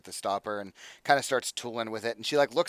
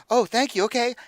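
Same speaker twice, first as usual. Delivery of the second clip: chirpy thanks; 12 semitones higher, 8 dB louder than usual. Acted. GM voices Serene taking vial.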